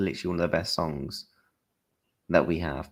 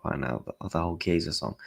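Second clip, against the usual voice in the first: ordinary talk, nothing marked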